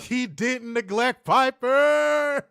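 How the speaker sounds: high, drawn-out comic yell; mock indignant shout. Host teasing co-host about Piper, mock outraged yell. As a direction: holler it slow, stretching the last word. Mock outcry defending a friend.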